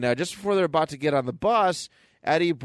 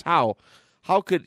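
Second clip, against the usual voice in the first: mock-panicked exclamation, higher; retold freak-out; 5 semitones higher, 4 dB louder, slower than usual. Podcaster retelling a comic hero freaking out, mock-panicked. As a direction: act out a character freaking out. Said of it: "Peter's freaking out".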